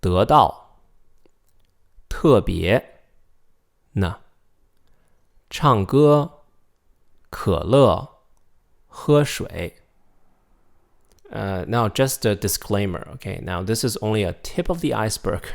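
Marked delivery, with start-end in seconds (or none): 0.0-9.8 duidelijk, woord voor woord; Mandarin practice words. Shown as a demonstration. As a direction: Mandarijnse oefenwoorden voorzeggen. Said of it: "time for some practice".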